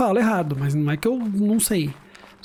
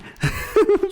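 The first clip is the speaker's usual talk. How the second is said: a real, high laugh breaking out; big laugh; 13 semitones higher than usual. Real, not acted. Laughing at his own story.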